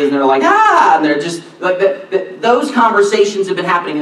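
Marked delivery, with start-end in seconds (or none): 0.4-1.0 short high alarmed yelp; a sudden startled cry; alarmed shriek. Retold or imitated. Disciples react to death prediction.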